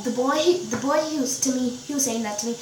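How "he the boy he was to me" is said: ordinary talk, nothing marked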